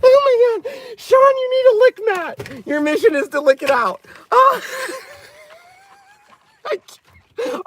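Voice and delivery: high, squealing laughing fit; crying with laughter. Real, not acted. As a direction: squeeze the words out through tearful laughter. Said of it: "I'm crying now".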